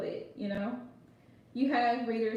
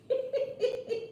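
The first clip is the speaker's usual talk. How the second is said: high burst of laughter; 11 semitones higher than usual. Real, not acted. Host cracking up at Tyler's troll comeback, high laughter. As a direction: giggle up high, real amusement.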